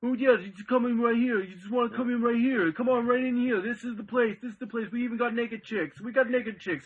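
high, loud doorman hustling people in; intense doorman voice. Retold or imitated. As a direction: call them in like a club doorman, pitched high and loud. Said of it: "That felt like the doorman... it was intense".

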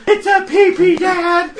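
put-on high, loud kid protest; child's voice. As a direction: insist like an indignant kid. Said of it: "and he goes".

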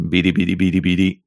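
lightly voiced beeping robot. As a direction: chirp it like a little TV robot. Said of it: "so he had to speak like a robot".

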